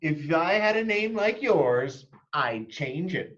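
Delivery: high and slow, lightly voiced mean girl; snide classmate. Victoria taunting Chrysanthemum in line, high and slow. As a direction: sneer it like a snooty child. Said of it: "Victoria said".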